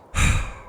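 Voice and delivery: short, audible sigh. Acted. Loki facing Ullr's arrows, short dismissive sigh. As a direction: let out a quick huff of breath. Loki sighs before answering Ullr.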